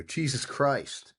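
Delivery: slow, loud, stunned exclamation; shocked gasp. Retold or imitated. Boy seeing his mom holding a gun, stunned and loud. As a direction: let it out high and dragged, in disbelief.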